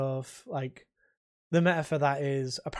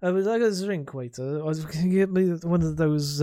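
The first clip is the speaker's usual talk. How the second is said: put-on slurred drunk, pitched up; drunk millionaire; 4 semitones higher, 3 dB louder than usual. Shown as a demonstration. Drunk millionaire asks for a drink, slurring, higher voice. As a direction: slur it like a rich bum after a drink. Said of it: "he is a drunk millionaire".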